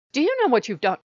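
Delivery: sharp, loud and high, accusing; accusing rebuke. Acted. Monk Nomi confronts Yesmi, loud accusing rebuke. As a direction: confront him with what he did.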